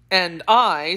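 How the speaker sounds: plain reading voice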